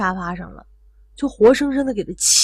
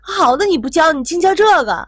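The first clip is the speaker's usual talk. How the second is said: loud, raised-pitch retold reproach; angry scolding; 7 semitones higher, 7 dB louder than usual. Daughter blames her mother for backfiring help, angrily. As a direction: act out a daughter lashing out. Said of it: "正在气头上".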